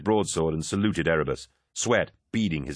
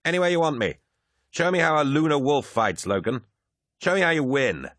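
high, taunting swagger; exultant high-pitched challenge; crowing; 8 semitones higher than usual. A narrator's reading. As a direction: goad him like a cocky champion. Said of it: "crowed Lucius".

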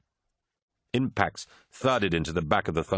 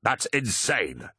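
slow, incredulous; plain disbelief; 3 semitones higher, slower than usual. A narrator's reading. Captain rejecting his honor brother's warning, disbelieving. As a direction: draw it out in disbelief. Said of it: "disbelief plain in his voice".

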